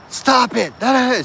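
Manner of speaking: high, loud retold yell. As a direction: yell at the unseen thing. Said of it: "She just keeps yelling at it".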